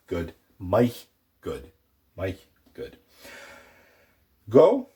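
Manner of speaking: ordinary talk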